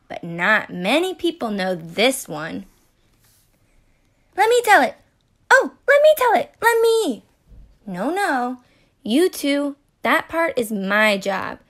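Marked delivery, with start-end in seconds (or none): 4.4-4.9 high, louder eager plea. Acted. Other characters want to tell story. 5.5-7.2 high, fast, louder begging voice; eager begging. Acted. Other characters want to tell story.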